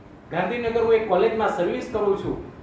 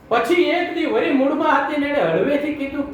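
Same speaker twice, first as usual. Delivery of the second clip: ordinary talk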